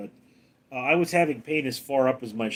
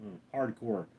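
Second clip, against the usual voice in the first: usual speaking voice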